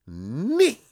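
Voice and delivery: high, loud mock knight shout; knights who say Nee. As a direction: bark out the silly knight cry.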